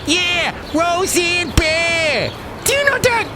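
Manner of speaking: high, drawn-out cheering yell; excited shout. Acted. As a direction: whoop it out like a fan. Host cheers the guest's pick.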